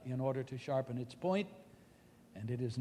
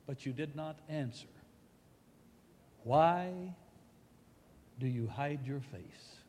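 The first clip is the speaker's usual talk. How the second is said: softer, hushed pleading question; anguished plea; 5 dB quieter than usual. Acted. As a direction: plead quietly into the silence. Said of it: "comes this poignant cry".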